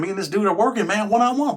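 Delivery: ordinary talk, nothing marked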